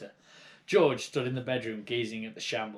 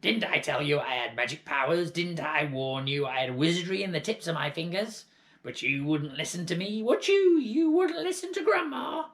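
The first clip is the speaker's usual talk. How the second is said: boastful crone; 5 semitones higher, 5 dB louder than usual. Acted. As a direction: lord it over the boy. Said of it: "she answered".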